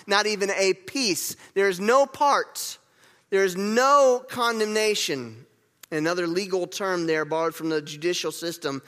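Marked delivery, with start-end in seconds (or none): 1.6-5.5 slow, with "no" stressed each time; emphatic NO. Real, not acted. Preacher explains that no condemnation remains, emphatic "no". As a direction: hammer the word "no" slowly in both sentences. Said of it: "that's a very emphatic no".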